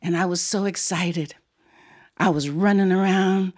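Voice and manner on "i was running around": ordinary delivery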